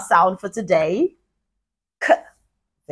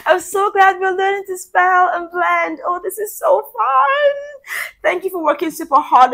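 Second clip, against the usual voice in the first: enthusiastic teacher; 9 semitones higher than usual. Real, not acted. Teacher praising class.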